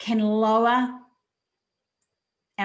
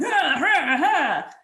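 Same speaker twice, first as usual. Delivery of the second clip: higher, mock-panicked ah sounds with a laugh; panicky gasps; 6 semitones higher, 5 dB louder, much slower than usual. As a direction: gasp like you're losing it, half laughing. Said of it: "rather than going".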